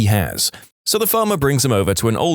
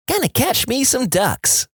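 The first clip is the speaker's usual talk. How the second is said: put-on high, slow child voice; kid; 13 semitones higher than usual. A narrator's reading. Kid answers old man on porch, high child voice. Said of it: "says the kid".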